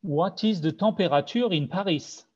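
English question with a heavy French accent, French r and vowels; thick French accent. Shown as a demonstration. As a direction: ask it in a strong French accent, "tɑ̃peʁatyʁ" and "paʁis". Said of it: "but with a thick French, my thick French accent".

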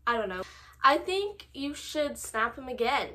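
ordinary delivery

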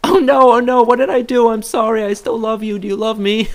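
a guilty dog begging to still be loved; high, loud pleading voice for a stepped-on dog; anxious dog voice. Retold or imitated. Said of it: "They'll just be like".

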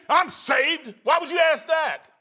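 loud, high indignant snap; bitter, offended retort. Retold or imitated. Churchgoer asked whether they are saved, bitterly indignant. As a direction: act affronted at being asked. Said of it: "why would you be bitter about it".

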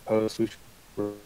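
ordinary delivery

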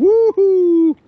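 high, loud cheer; excited whoop. Real, not acted. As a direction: whoop it up over the catch. Catching a fish, cheering.

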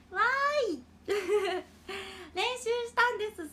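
high, loud, giddy pride; excited. Real, not acted. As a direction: squeal with proud joy. Proud of learning rolled r.

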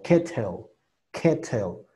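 "kettle" with a clear strong T, "keh-tel"; "kettle" said with a crisp middle T; hard T. Shown as a demonstration. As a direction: sound the T fully in "kettle". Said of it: "Puedes usar cualquiera de las dos".

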